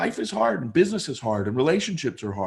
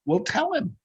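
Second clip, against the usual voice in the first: much higher, lightly voiced mother nudging; a mom prompting her daughter, pitched up; mother urging; 11 semitones higher than usual. Mom telling daughter to speak, high mock voice. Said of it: "looks at her daughter and says".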